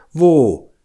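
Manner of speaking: high, loud, eager burst; eager question. A narrator's reading. Bear hearing of a honey nest, eagerly asking. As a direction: pounce on the question, greedy for honey.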